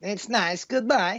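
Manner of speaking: high-pitched mock-Kazakh Borat accent; Borat impression. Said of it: "You have to do your Borat".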